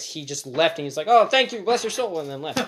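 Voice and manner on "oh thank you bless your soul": retold gushing thanks. Beggar's gratitude, retold.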